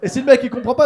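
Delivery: loud, high, worked-up telling. Retold or imitated. Telling how the driver fails to understand, loud and agitated. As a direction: keep it shouted and agitated. Heated telling in hitchhiker's raised voice.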